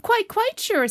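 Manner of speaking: very high, loud assurance; high, loud certainty; emphatic insistence. Acted. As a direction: insist eagerly that it's true. Pooh insists who he is.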